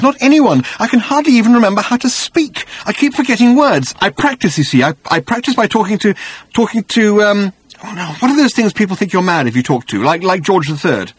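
high-pitched, agitated rambling; overwhelmed, bewildered. A narrator's reading. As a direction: blurt it out, groping for the words. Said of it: "sudden access of emotion and bewilderment".

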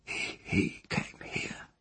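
slow, faltering whisper; a frightened child whispering, slow and halting; faint hoarse whisper. Acted. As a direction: barely breathe the words out. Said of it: "her faint, hoarse whisper".

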